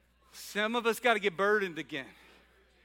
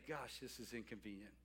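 much softer, grumbled under the breath; softer, quicker grumbling aside; 8 semitones lower, 11 dB quieter than usual. Retold or imitated. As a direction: mutter it like a reluctant shepherd to yourself. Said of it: "which person wouldn't say".

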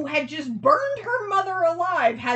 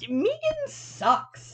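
disdainful; 12 semitones higher, slower than usual. Acted. Mock disdain about character.

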